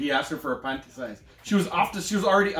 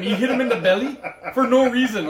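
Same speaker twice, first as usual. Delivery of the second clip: laughing; 7 semitones higher, 6 dB louder than usual. Real, not acted. Laughing while retelling the scene.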